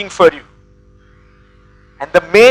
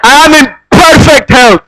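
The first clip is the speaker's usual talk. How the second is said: shouted affirmation; 4 semitones higher than usual. Shown as a demonstration. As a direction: belt it out to the whole hall. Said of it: "Tell the person on your left and right".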